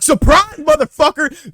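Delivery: high, loud yell bursting in; re-enacted surprise attack, shouted; ambush shout. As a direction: holler it high as if springing an ambush. Acting out kicking in the stall.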